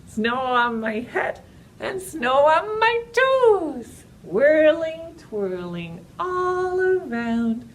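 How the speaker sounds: slow singsong rhyme for toddlers; drawn out, playful storytime chant; slow action rhyme. Acted.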